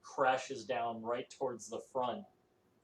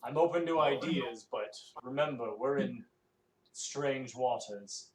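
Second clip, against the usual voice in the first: captain's warning; 5 dB louder than usual. Acted. Captain NPC replies to player.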